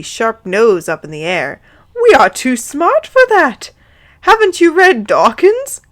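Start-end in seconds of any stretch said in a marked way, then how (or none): 1.9-5.8 high, loud hammed-up snootiness; mock-haughty aunt, pitched right up; snooty scoffing.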